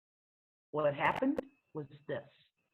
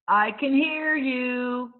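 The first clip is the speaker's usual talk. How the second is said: loud, slow hollered call; slow defiant holler to the boys behind; 6 semitones higher, 7 dB louder, much slower than usual. Acted. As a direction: yell it back at the boys, drawn out. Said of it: "I hollered back at them".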